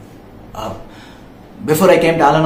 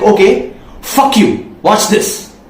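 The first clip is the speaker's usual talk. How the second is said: higher-pitched defiant challenge; defiant anger; 5 semitones higher, much slower than usual. Acted. Sibling cut out of the restaurant vows payback, defiant. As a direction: throw it down like a dare. Voicing past defiant resolve toward brother.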